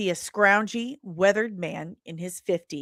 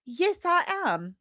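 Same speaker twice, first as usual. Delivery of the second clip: higher, hesitant reporter voice; stammering, much higher; flustered Layla; 5 semitones higher, slower than usual. Acted. Reporter coughs through smoke, answering Bob in a flustered high voice.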